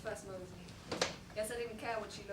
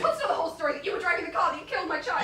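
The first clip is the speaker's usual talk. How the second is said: loud, raised, accusing; angry confrontation; 5 semitones higher, 9 dB louder, faster than usual. Acted. Father confronting the driver who killed his daughter, angry and raised. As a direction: hurl the accusation at him.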